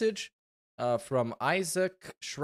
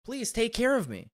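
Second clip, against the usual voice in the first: higher-pitched needy pet pleading for tips; pleading virtual pet; 8 semitones higher than usual. Pretend. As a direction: beg like a needy Tamagotchi. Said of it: "So he just tells you".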